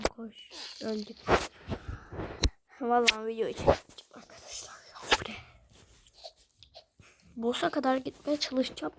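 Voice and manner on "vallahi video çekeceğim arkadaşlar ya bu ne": quick whispered aside; fast whisper. Real, not acted. Gamer to her viewers mid-game, fast whisper. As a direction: whisper it quickly to your friends.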